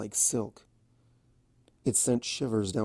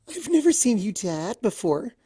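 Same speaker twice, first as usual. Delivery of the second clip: gentle pony voice, higher and amused; higher voiced Fluttershy; 10 semitones higher, 3 dB louder than usual. A narrator's reading. Fluttershy laughing at Discord's pogo trick, higher and sweet. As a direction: lift it up, sweet and charmed.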